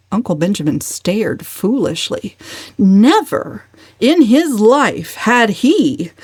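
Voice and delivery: plain reading voice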